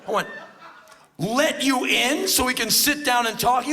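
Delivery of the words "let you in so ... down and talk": loud, high disbelieving echo; incredulous shouting. Retold or imitated. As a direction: throw it back in loud disbelief. Acted incredulous reply to intruder.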